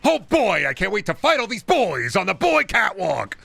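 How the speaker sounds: high, loud, hammed-up glee, slowing on smorgasbord; sarcastic over-the-top joy; mock cheer at the enemy horde; mock excitement.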